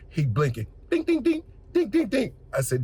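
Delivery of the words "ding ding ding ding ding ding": high sung ding ding; high mock airplane bell; imitated chime.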